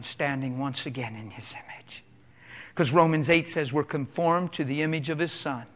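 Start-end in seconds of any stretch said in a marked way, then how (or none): 0.0-1.7 whispered and much softer; awed whisper to the congregation. Real, not acted. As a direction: hush it like a secret. Preacher drops to a whisper.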